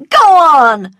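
high, loud shout; angry cry. A narrator's reading. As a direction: shout to drive him away. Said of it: "cried Scarlet".

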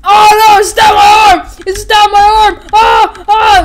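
high, loud panicked screaming. Retold or imitated. Toy hero screaming as the sword falls. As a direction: shriek in pain and panic. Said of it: "He's like".

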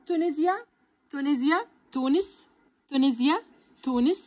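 "تونسيا" with the s said as z; z sound drill. Shown as a demonstration. Teacher drills the s as z for students. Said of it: "بتتنطق كأنها بساوند الزد".

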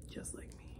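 hushed storytelling whisper. Acted. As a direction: let it fall to a secret. Caterpillar hatches from the egg.

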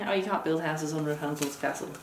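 ordinary delivery

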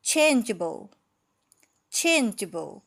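"changeable" with loud CHAIN, juh-bul falling away; first-syllable stress. Shown as a demonstration. Teacher drills the whole word, first-syllable stress. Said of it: "เน้นที่พยางค์ที่หนึ่ง".

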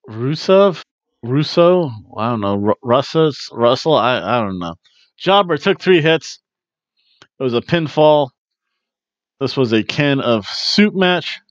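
normal talking voice

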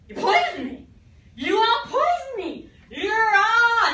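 loud, high accusing yell; outraged shout. Acted. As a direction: roar it at the poisoners in mock outrage. Poisoned Rasputin accuses his killers.

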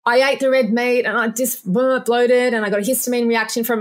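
a hint of a patient whining about red meat; mimicking a patient. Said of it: "'Cause someone will say".

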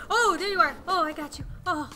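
usual speaking voice

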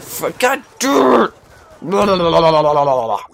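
loud, high shout breaking into babble; mock yelling. Gamer complaining of someone yelling, mock yelling. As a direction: yell it out, then babble loudly. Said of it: "like someone's yelling".

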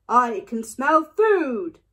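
loud, slow hungry yell. A narrator's reading. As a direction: call it out big and drawn out. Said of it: "yelled a voice from nearby".

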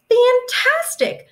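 high, slow, drawn-out cry of joy; thrilled the chimps have lost their fear; delighted. Acted. Young Jane, thrilled the chimps aren't scared anymore, exclaiming high and slow.

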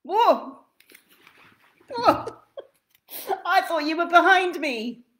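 startled guest cries out; startled jump. Acted. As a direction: jump at the sight of a second twin. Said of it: "went in and jumped".

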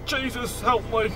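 hushed, helpless prayer; soft, cowed little appeal; meek plea. Acted. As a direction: plead quietly for rescue under your breath. Cowed character prays for help.

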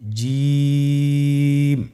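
জিম held out very long on one pitch; long drawn jim. Shown as a demonstration. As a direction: draw জিম out slowly, three alif long. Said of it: "আবার বলুন". (জিম তিন আলিফ টেনে লম্বা করে)

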